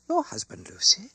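high-pitched, alarmed query; startled question. A narrator's reading. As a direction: ask in startled disbelief, voice jumping up. Startled friend questions frantic woman.

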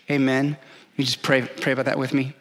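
ordinary talk, nothing marked

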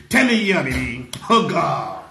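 low, drawn-out pretend cry; mock English screaming. Showing someone how to scream with English, low mock cry. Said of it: "Scream with English".

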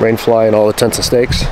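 ordinary talk

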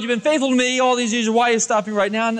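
ordinary talk, nothing marked

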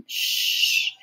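voiceless, gentle shushing; soothing hush. A narrator's reading. Kira rocks the tyke, soothing hush. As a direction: shush to settle a child. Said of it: "the tyke was soothed".

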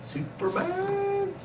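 high, slow stretched Superman; drawn-out high call. Pretend. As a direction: sing it out long and high. Echoing Superman, sung out.

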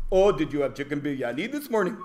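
quick, lilting put-on Indian accent; lightly hammed-up Indian accent; mock Indian accent. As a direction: imitate an Indian accent, asking casually. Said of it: "if I'd done it with that accent".

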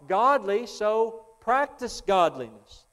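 loud, high, full-force preaching; hammering revival preacher. Acted. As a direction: drive the point home from the pulpit.

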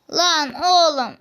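high, loud, drawn-out protest; slow, angry wail at the game; exasperated outburst. Real, not acted. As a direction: drag the words out in loud frustration. Cursing the game's accessory drop.

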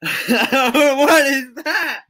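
high, loud, drawn out through laughter; laughing hard. Real, not acted. Streamer seeing a funny image in chat, laughing hard. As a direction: stretch it out while cracking up.

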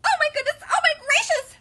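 very high, loud huffing chick panic; Chicken Little. A narrator's reading. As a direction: squeal it in frantic alarm. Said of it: "huffed Chicken Little".

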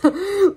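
a quick high laugh at her own slip; short high laugh after tripping over a word; embarrassed laugh. Real, not acted. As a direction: laugh off the stumble.

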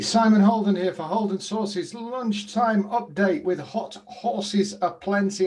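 loud, bright-pitched show host greeting; announcer opening. Acted. Tipster greeting viewers, loud announcer-style opener. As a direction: kick off the tipping update like a radio host.

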